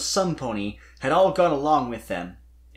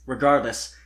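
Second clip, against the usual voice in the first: usual speaking voice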